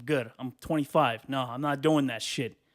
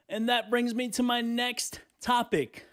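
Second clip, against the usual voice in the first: slow, raised announcer delivery; topic announcement; 7 semitones higher, slower than usual. Pretend. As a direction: stretch out the segue like a show host. Mock-formal segment announcement.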